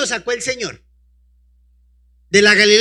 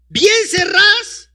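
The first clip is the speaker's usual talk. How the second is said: bien cerradas asked high and slow, rising on bien; high drawn-out question; 12 semitones higher, 5 dB louder, much slower than usual. Shown as a demonstration. Preacher quizzing the congregation, high slow rising question. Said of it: "¿Y las puertas estaban cómo?".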